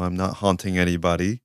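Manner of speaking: ordinary delivery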